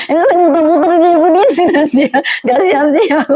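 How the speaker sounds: high, fast words through laughter; real laughing. Real, not acted. Preacher amused at her own parody, laughing as she talks. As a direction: giggle through the words, rushing.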